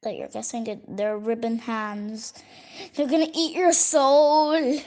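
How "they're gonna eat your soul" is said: louder mock monster voice; spooky monster threat. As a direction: growl it out like a hungry monster. Spooky threat about drawing.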